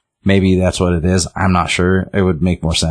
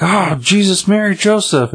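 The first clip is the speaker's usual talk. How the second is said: very high, mock-shocked exclamation; hammed-up high-pitched fright; startled old lady; 14 semitones higher than usual. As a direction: imitate an old lady frightened out of her wits.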